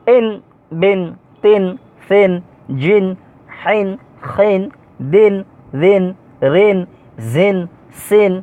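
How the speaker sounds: careful Arabic letter sounds, one measured syllable at a time; pronunciation drill. Shown as a demonstration. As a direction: recite each syllable separately, from ইন to উইন, with a pause after each. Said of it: "আমি পড়তেছি, আপনারা মনোযোগ সহকারে শুনুন". (ইন থেকে উইন, থেমে থেমে স্পষ্ট উচ্চারণ)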